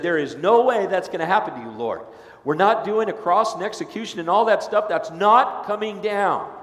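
loud, high insistent denial; emphatic protest. Acted. Peter loudly refusing the cross to Jesus, emphatic shout.

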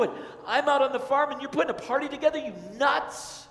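loud, high-pitched shouting rant; furious older son. Acted. Older son yelling at his father over the feast, furious. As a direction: rage at the father over the party.

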